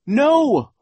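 very high loud cry; desperate no. A narrator's reading. Klaus as the door is pulled shut, desperate cry. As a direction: cry out in refusal. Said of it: "Klaus cried".

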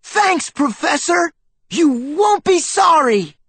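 put-on very high thrilled child; excited exclaim. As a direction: burst out with eager thanks. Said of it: "he exclaimed".

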